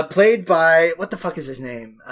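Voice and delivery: ordinary talk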